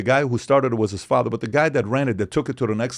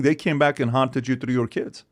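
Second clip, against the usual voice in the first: usual speaking voice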